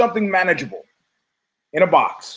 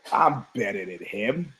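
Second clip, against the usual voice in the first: low muttered boast; 7 semitones lower, 8 dB quieter than usual. Retold or imitated. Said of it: "I stood up from that mat and go".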